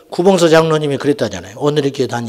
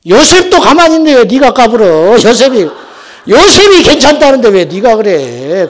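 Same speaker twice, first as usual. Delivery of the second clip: loud, high comic outburst; fed-up angry; 7 semitones higher, 10 dB louder than usual. Retold or imitated. Exasperated elder telling off a quibbling new believer, angry mock shout. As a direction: imitate an elder losing his temper. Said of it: "성질이 나가지고 일어나가지고".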